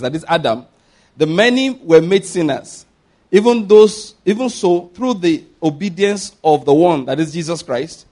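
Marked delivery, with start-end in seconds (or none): 1.2-7.0 loud, emphatic Bible reading; scripture proclamation. Acted. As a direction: proclaim the verse forcefully to the congregation. Reads scripture aloud to congregation.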